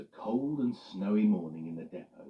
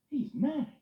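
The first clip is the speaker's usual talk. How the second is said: Eddie grumbling; 6 dB louder than usual. Acted. Eddie voiced.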